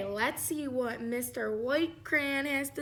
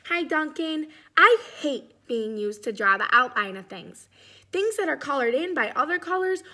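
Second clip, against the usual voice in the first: much louder, indignant crayon voice; 3 semitones higher, 7 dB louder than usual. Acted.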